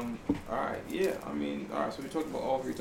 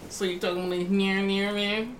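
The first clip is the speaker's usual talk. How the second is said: mock mumbling rapper, pitched up and droning; higher, smeared mumble impression; 5 semitones higher, 4 dB louder than usual. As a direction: imitate a rapper's garbled whine.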